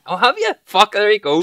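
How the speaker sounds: loud, surprised delight at the news. Real, not acted. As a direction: blurt it out, caught off guard. Friend reveals he sampled it.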